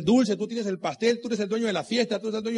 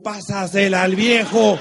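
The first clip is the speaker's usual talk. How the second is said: louder and slower, "viejo" drawn out; retold shout; 7 dB louder, slower than usual. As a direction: call it across the court like the kids did. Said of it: "le gritaban a los". (grito recordado, más fuerte y lento)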